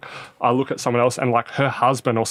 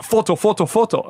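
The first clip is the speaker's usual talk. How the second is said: higher, insistent mock fan voice; pestering fan; 9 semitones higher than usual. Pushy fan badgers player on a toilet break, mock pestering. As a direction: imitate someone pestering for a photo.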